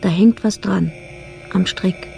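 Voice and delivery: rising fear at what dangles below; growing dread. Acted. Jeanette peering into the dark shaft, growing fear. Discovering something hanging in shaft.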